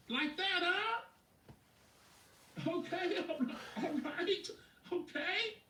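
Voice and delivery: high, laughing, impressed reaction; delighted amazement. Acted. Coach seeing his client's new Maserati, delighted amazement. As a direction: pitch it way up, chuckling with approval.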